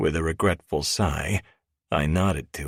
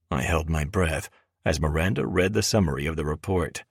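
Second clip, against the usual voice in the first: usual speaking voice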